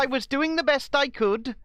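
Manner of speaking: a hint of a Welsh politician; Welsh accent. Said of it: "That was kind of Welsh".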